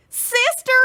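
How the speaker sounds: high calling shout. Acted. Baby shouting for her sister in fright. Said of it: "shouted Baby".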